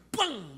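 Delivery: loud, sudden bang sound effect; imitated bang. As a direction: punch out a bang mid-story. Imitates the sudden car moment. (tiruan bunyi "bang" yang keras)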